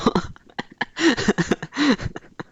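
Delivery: a burst of high, bright laughing; real laughter. Real, not acted. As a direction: genuine amused laugh. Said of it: "I'm laughing because".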